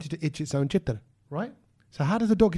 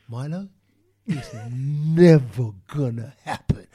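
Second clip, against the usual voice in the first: never drawn out slow and louder; stress on never; 5 semitones lower, 4 dB louder, much slower than usual. Retold or imitated. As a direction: hit the word never hard, slowly. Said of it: "I couldn't emphasize the word never".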